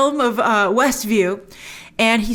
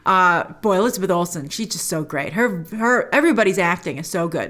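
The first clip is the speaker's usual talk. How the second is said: usual speaking voice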